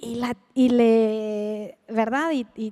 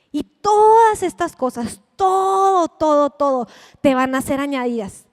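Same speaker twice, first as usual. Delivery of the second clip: fuerte; 3 semitones higher, 9 dB louder than usual. Real, not acted. Predicadora proclama la promesa.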